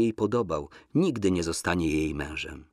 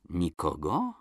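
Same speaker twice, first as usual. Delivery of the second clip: mistrustful softer question; distrustful; 3 semitones lower, 6 dB quieter, slower than usual. A narrator's reading. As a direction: doubt her, rising at the end. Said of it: "zapytał nieufnie".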